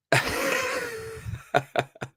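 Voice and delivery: high, squealing burst of laughter; a startled, delighted high laugh; surprised laugh. Real, not acted. Moved by a donation.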